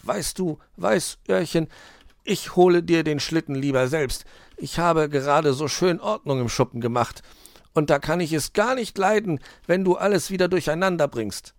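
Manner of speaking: high, careful fox voice; cajoling fox. A narrator's reading. Fox stops Weißohr fetching the sledge, cajoling. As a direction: coax the youngster away from the shed.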